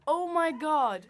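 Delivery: high loud amazement; excited. Real, not acted. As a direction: gasp it out, thrilled. Amazed by the bark.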